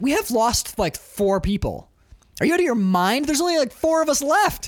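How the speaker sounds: high, exasperated mock outburst; incredulous protest. Crewman arguing after four deaths, incredulous high protest.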